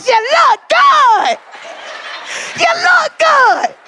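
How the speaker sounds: high, slow hyped shout; celebration shout. Retold or imitated. Hair braider to her client, high celebratory shout. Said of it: "We be like".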